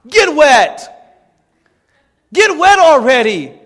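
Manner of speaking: high, forceful preacher's command; exasperated shouted challenge; loud exhortation. Real, not acted. Exhorts the doubters, building up.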